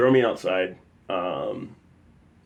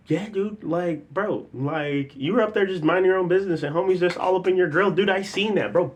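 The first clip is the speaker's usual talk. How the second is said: higher-pitched imitated bro voice; mock hyped friend taking your side; supportive friend; 9 semitones higher than usual. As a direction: back up your buddy, pitched up.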